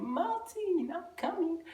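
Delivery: high mocking imitation of a mother's accent; mocking the mom's accent. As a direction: classmate repeating the mom's accent, high and teasing. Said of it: "laughing and shit, saying".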